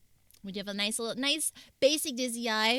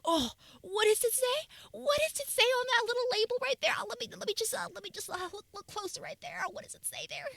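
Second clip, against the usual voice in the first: put-on high squinting old lady voice; peering at a label like a granny; 13 semitones higher, faster than usual. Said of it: "my auntie glasses on".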